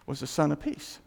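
ordinary delivery

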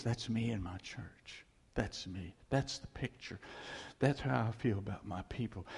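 low, soft, tender voice; Jesus speaking fondly. Acted. Jesus watching a wedding, low and tender. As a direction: soften and drop it, quietly claiming them.

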